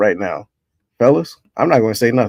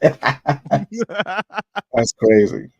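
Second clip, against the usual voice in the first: laughing; 3 semitones higher, much slower than usual. Real, not acted. Cracking up at friend's joke.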